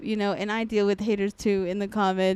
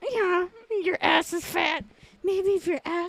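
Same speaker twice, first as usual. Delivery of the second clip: hammed-up high-pitched scolding; mock scolding mom; 8 semitones higher than usual. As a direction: nag like an exaggerated mother. Voicing mom's catcall scolding.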